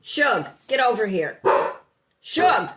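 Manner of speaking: loud, high call building to a shout; dog owner losing patience, shouting. Real, not acted. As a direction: yell for the dog to come back. Calling the misbehaving dog.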